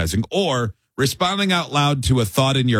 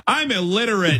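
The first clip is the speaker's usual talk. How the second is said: higher playing dumb on "I'm illiterate"; mock dumb excuse; 7 semitones higher than usual. Making excuses to a mannequin, a higher playing dumb "I'm illiterate". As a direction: pitch "I'm illiterate" up silly. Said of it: "Whatever your go-to".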